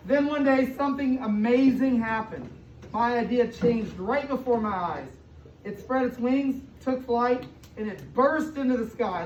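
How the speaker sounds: storybook read-aloud rising into excitement; building wonder. A narrator's reading. Teacher reading the climax of the story to her class, rising excitement. Reads picture book to kids.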